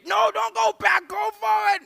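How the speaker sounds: very high, loud frantic shout; hammed-up shrieky outburst; panicked exasperation. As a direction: demand an answer in utter alarm. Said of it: "We're panicking at the robots".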